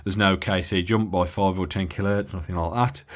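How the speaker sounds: ordinary talk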